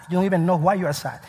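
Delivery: ordinary talk, nothing marked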